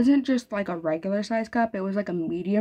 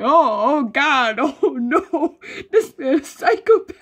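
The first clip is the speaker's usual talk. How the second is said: laughing horror, high and loud; terrified laughing; 5 semitones higher, 7 dB louder, slower than usual. Retold or imitated. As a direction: gasp out in shocked horror. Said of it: "He's like".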